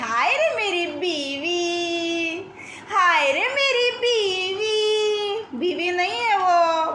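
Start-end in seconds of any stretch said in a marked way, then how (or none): 0.0-5.6 slow sung mock crying; hammed-up wail. Mocking the dreamer, he wails in a slow singsong for his wife. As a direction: drag it out like a fake mourner.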